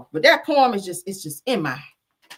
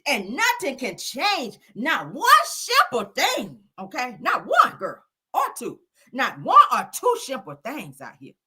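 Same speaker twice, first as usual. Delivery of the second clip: slow, emphatic verse; hammed-up recital; dramatic poem reading; 4 semitones higher, slower than usual. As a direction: build it line by line.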